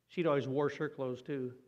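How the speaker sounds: ordinary delivery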